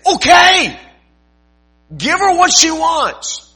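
high, loud, slow surrender; exasperated judge giving in. Acted. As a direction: throw up your hands and give in. Judge finally gives in.

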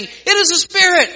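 high, loud cry of a frightened sailor; fearful cry. A narrator's reading. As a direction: shout it out in alarm. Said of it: "And they cried out for fear".

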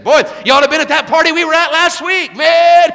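loud, high, showy partygoer boast; a bragging young man shouting across the booth; mock bragging shout. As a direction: crow about the party like a show-off.

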